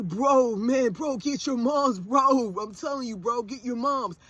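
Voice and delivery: mock jock warning, bro after bro; taunting boys. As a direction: imitate a cocky teen heckler. Mimics boys taunting her son.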